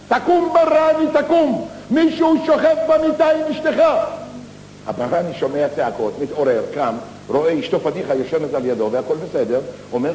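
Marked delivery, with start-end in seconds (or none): 0.1-3.8 high shouted warning from outside; shouting. Acted. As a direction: yell to wake the sleeping husband. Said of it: "והתחיל לצעוק מבחוץ".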